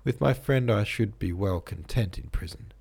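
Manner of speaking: ordinary delivery, nothing marked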